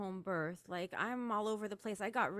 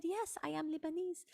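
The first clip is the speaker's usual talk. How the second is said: put-on Lebanese accent; 7 semitones higher than usual. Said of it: "And she had this accent".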